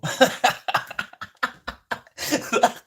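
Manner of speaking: a long, loud, high wheezing laugh. Real, not acted. Vlogger cracking up over his own story, wheezing high.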